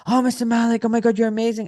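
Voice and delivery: hammed-up fawning praise; gushing staff.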